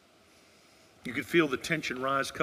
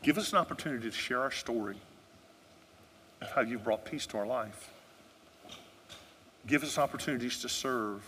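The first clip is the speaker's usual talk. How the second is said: closing prayer; 6 dB quieter than usual. Acted. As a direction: pray gently over the people.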